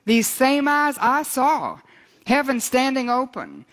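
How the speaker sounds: high, ringing pulpit reading; scripture reading. Acted. Preacher reads John's vision to the congregation, proclaiming scripture.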